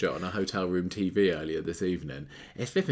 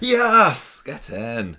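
loud, high burst of triumph; triumphant cheer; 5 semitones higher, 9 dB louder, much slower than usual. Real, not acted. Quiz contestant cheering a right answer, triumphant. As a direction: shout it in delight at scoring.

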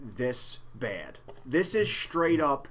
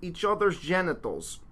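broken Russian man, deliberate mock accent; sad Russian accent. Host reading a survivor's account as asked, sad Russian accent. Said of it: "say it like a sad, broken Russian man".

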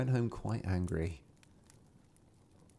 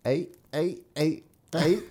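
frog croak; 6 semitones higher, slower than usual. Acted. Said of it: "what were they saying? Ache".

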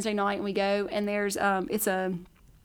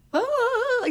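high, loud emotional oh; overwhelmed oh; 5 dB louder than usual. Retold or imitated. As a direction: gasp it out, moved. Overwhelmed, crying in church.

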